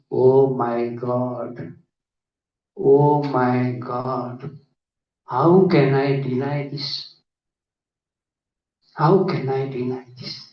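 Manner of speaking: drawn-out, very slow awe; stunned disbelief. Retold or imitated. Psychiatrist realising he cannot deny past lives, slow stunned awe. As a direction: stretch each word as if dumbfounded.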